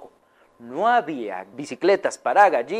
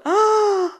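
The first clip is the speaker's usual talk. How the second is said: high, loud shriek of fright; frightened shriek; 5 dB louder than usual. Acted. Scared animals shrieking at the hen's tantrum, high shriek. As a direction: let out a sudden scared cry.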